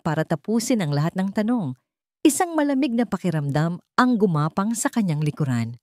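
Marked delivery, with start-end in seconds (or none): none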